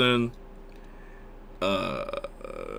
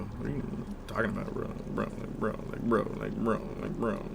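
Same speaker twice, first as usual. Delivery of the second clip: muttering; 9 dB quieter than usual. Real, not acted. Muttering bro repeatedly.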